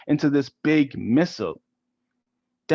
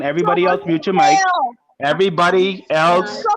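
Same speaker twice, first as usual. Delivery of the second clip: firm teacher command; 4 semitones higher, 5 dB louder than usual. Real, not acted. Teacher silences class.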